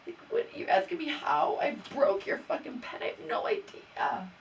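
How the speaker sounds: high, loud, breathy-edged outburst of disbelief; can't believe he's asking; exasperated disbelief. Real, not acted. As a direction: blurt it out, unable to believe the question. Exasperated rant about the pen.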